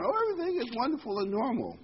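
mock the oblivious crowd; mock cheerful. Mocking people who ignore danger.

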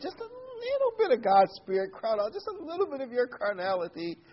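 high, far-off put-on voice of the old friend; quoting a friend. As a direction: pitch it way up as the friend teasing. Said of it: "He said".

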